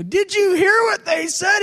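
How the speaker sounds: outraged protest to God; indignant shout. Retold or imitated.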